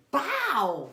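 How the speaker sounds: high punchy bow for the hit; imitated punch sound. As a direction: pop out the blow like a comic strike. Imitating the blow.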